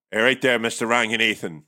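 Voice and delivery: mock Northern Irish phone greeting; Belfast accent. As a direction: answer the call in a put-on Belfast accent. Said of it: "they've sent you to Belfast".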